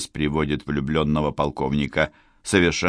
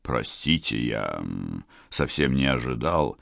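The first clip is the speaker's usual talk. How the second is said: low, softer, hesitant officer; flustered colonel; 7 semitones lower, 7 dB quieter than usual. Acted. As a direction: caught unawares, apologising softly. Colonel caught off guard.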